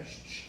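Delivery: very soft double "shh"; imitated gun noise. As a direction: hiss twice quietly for a gun. Imitates the wizard handling his gun.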